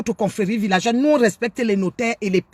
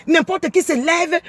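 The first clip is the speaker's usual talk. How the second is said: normal talking voice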